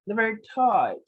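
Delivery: hammed-up playboy introduction, loud start; Austin Powers. Host giving himself a very sexual alias, Austin Powers voice. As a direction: introduce yourself as a comic lothario. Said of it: "Austin Powers".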